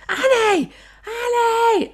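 high-pitched desperate cry for Annie; hammed-up calling out to a casualty; melodramatic soap acting. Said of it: "I was basically auditioning for EastEnders".

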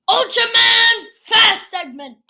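big loud segment announcement; announcer voice. Acted. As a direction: announce it like a show host.

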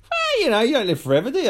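high-pitched, airy shrug; mock breeziness. Excusing walking past an old lady, high and breezy. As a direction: brush it off in a high mock-casual voice.

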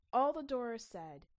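ordinary delivery

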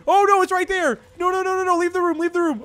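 high panicked pleading; frantic, terrified cries; scared horror game streamer. Real, not acted. Chica right there, fleeing.

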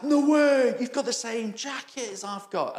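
high, eager delight; excited. Retold or imitated. Cyclist thrilled to spot the same jacket, high and excited. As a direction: gush like a thrilled enthusiast.

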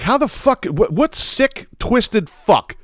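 high, drawn-out disbelief; incredulous disgust. Retold or imitated. Host reacting to the husband's betrayal, high incredulous disgust. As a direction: stretch the words out high, appalled.

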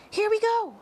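very high, loud shout; excited cry. A narrator's reading. Miss Spider as the room lurches again, high excited cry. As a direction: yell it at the top of the voice. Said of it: "cried Miss Spider".

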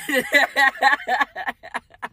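big rolling burst of laughter; loud high laugh. Real, not acted. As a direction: crack up loudly at your own words. Laughs at own admission.

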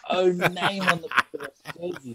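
high, jeering Geordie-free teammate voice mocking him. Teammates roasting him over jersey.

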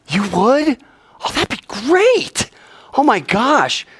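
high mock-excited gush; fake delight. Brothers pretending joy at Shechem's proposal, high fake excitement. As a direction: overplay the thrilled surprise.

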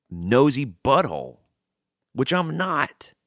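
high, emphatic venting with pauses; angry-sounding rant. Real, not acted. Venting about getting no breaks at work, sounding worked up and angry. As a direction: rant indignantly, landing each phrase hard. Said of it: "I'm not as angry as I sound".

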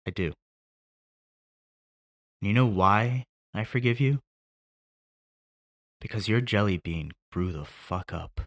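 son forgiving his father at the grave; tender forgiveness. Acted. Forgiving close of monologue.

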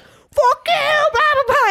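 hammed-up imitation of his screaming; retold scream. Date screaming at her in his house, mock yell. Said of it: "Then he was screaming at me".

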